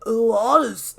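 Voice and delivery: the wrong character's voice; Dan's voice. Acted. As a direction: say it like Dan. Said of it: "that's the same voice as Dan".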